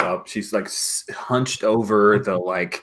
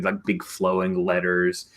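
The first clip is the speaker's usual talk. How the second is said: ordinary talk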